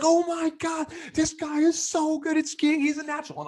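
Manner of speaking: screaming buddy. Retold or imitated. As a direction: squeal it like a thrilled friend behind the camera. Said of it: "and he's screaming. He's like".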